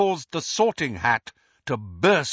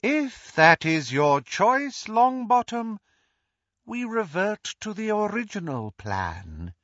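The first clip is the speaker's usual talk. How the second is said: Voldemort gloating; 10 semitones higher than usual. Acted. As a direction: gloat over the fallen hero to the crowd. Voldemort pronounces judgement.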